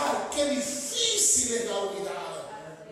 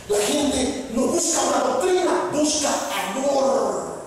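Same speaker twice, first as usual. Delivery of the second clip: higher, slower emphatic line; emphatic preaching; 6 semitones higher, slower than usual. Acted. Preacher stressing that people seek love. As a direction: drive the point home.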